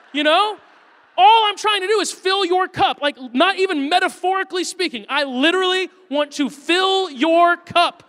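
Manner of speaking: loud, high-pitched mock exasperation; exasperated father. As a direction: plead indignantly with a stubborn toddler. Acts out exasperation at his son.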